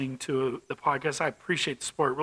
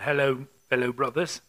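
mock British accent; 3 semitones lower than usual. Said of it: "Not that I'm from the United Kingdom".

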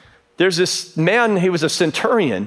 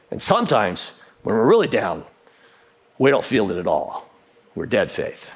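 downcast; 10 semitones lower than usual. Shown as a demonstration. Preacher describing being really down, sinking and deflated. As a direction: let it sag steadily down to a near-murmur.

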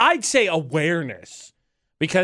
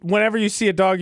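usual speaking voice

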